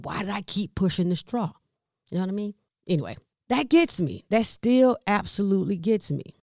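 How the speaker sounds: ordinary talk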